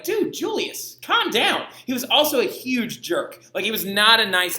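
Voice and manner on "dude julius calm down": high, exasperated dude scolding; mock scolding. Teacher telling warrior pope Julius to calm down, high and exasperated. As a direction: tell Julius to chill, amused.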